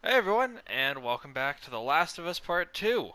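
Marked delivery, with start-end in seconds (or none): 0.0-0.6 loud, high channel intro; excited greeting. Real, not acted. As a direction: hype up the viewers. Opening greeting to viewers.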